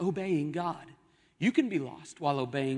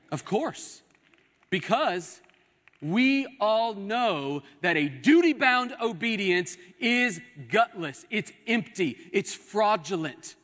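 slow, raised, emphatic sermon voice; preacher driving each point home, pitched up; fervent preaching; 5 semitones higher, 3 dB louder than usual. Real, not acted. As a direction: warn the congregation with conviction. Preaches with force.

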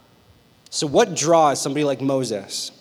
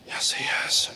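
whispered agreement; conspiratorial whisper; 8 dB quieter than usual. Acted. Friend agreeing about the cute guy, conspiratorial whisper. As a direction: whisper back like sharing a secret.

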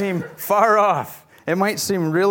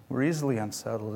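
softer, lower repeat of "We're easily unsettled"; quiet echo; 7 semitones lower, 8 dB quieter, faster than usual. Shown as a demonstration. Preacher repeating his point to the congregation, softer and lower. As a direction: drop the voice and repeat it fast and soft. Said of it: "repeated line, second softer".

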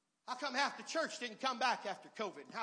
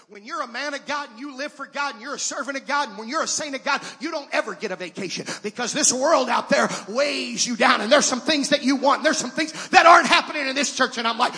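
rising from firm to shouted preaching; building to full-force preaching; 3 semitones higher, 21 dB louder than usual. Acted. Preacher to congregation, climbing into a shout. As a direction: build it up till you are shouting the gospel.

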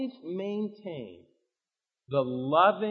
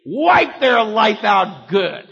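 slow, loud mock-cruel command; mock shouted order; 2 semitones higher, 10 dB louder, much slower than usual. Preacher voices a cruel God ordering ruin, slow shout. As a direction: bark it out slowly like a harsh tyrant. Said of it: "And God goes".

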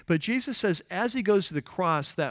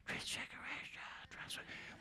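barely audible, whispered mock answer; timid whisper; 22 dB quieter than usual. Last service's crowd answering the preacher, timid whisper.

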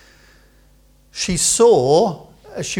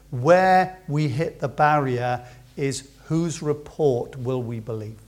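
ordinary talk, nothing marked

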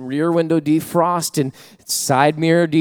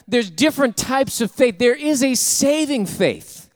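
high, loud fervent preaching, rising; full-force preaching; 8 semitones higher, 5 dB louder than usual. Acted. Pastor preaching fervently to his congregation, loud and high. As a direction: preach it hard to the church.

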